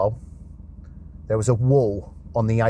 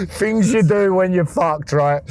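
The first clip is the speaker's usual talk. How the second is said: laughing; 7 semitones higher, 4 dB louder, slower than usual. Real, not acted. Laughing self-mockery.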